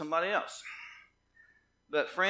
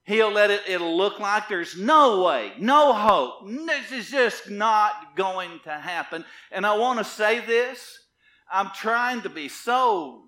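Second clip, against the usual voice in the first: loud, raised preaching voice, leaning on key words; forceful preaching; 6 semitones higher, 15 dB louder, slower than usual. Real, not acted.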